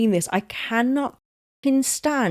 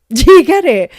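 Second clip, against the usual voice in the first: much louder and higher, excited; loud, high, hammered-home question; insistent shout; 7 semitones higher, 13 dB louder than usual. Real, not acted. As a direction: shout it to make sure the joke lands. Repeats question to push pun.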